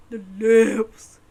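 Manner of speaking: ordinary delivery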